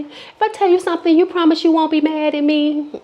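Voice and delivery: high, fast wheedling; pleading friend. Retold or imitated. Guilty drunk friend begging not to be mad, high. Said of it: "She said".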